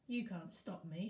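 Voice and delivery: ordinary delivery, nothing marked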